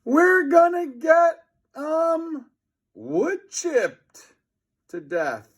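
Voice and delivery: high, slow squeaky dread; high terrified boy voice; panicked. A narrator's reading. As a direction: whimper out the doom. Heading for wood chipper.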